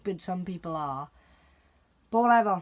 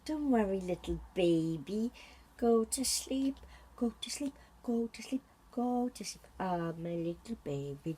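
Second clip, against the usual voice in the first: lullaby coo; 8 dB quieter than usual. Acted. Parent softly hushing a crying baby to sleep.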